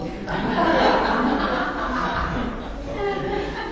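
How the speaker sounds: real laughter. Real, not acted. Preacher enjoying the boy's story, real laughter. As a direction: laugh at your own punchline.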